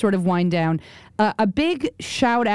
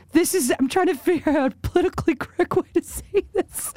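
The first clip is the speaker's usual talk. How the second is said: high, squeezed, laughing mock-quote; mocking, breaking up; 8 semitones higher than usual. Host ridiculing the commentator's preface, high and cracking up. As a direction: quote him while dissolving into laughter.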